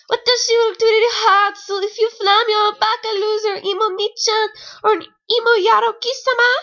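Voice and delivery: put-on high, loud cutesy anime-girl voice; squealing fake-Japanese schoolgirl; mock kawaii anime girl. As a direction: ham up a cringey weeb girl. Reading cringe weeb dialogue.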